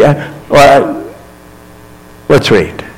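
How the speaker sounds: ordinary talk, nothing marked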